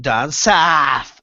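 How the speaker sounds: drawn-out mock southern "south"; mimicked southern accent. Telling mate he isn't southern, mock southern accent. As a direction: stretch it in an imitated southern accent. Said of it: "you put that accent on".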